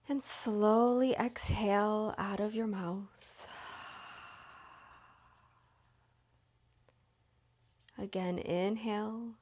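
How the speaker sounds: unhurried, even meditation voice, lingering between phrases; guided meditation. Real, not acted. Leads guided yoga nidra.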